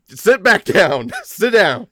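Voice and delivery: high, loud, slow order; yelling laughing teacher. Acted. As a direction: bark it through laughter.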